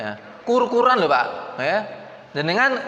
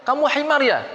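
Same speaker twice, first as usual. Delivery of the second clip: retold insult; 6 semitones higher than usual.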